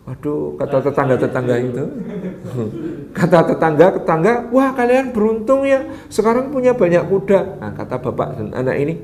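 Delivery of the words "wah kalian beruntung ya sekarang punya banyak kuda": high, gushing village gossip; envious neighbours. Acted. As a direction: pile on the fake praise, pitched up. (tetangga memuji dengan nada tinggi)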